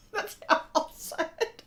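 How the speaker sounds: high, squeaky words through laughter; cracking up, barely getting it out; helpless laughter. Real, not acted. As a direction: laugh right through the sentence. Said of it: "I'm laughing in my head".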